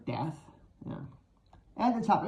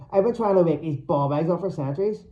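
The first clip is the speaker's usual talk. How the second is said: ordinary delivery, nothing marked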